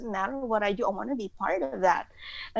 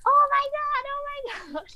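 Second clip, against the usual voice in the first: squealing joy; faster than usual. Retold or imitated. Girl hearing dad say yes to the concert, squealing. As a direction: squeal like a fan who just won.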